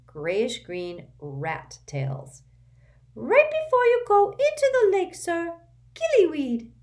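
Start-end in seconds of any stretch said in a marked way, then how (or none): 3.1-6.7 much higher, louder squeaky elf voice; urgent little servant squeaking; an anxious house elf waking his master. A narrator's reading. Dobby voiced.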